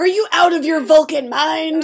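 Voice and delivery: lightly voiced exasperated McCoy; quoting McCoy. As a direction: toss off McCoy's line in exasperation. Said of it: "I do like that line".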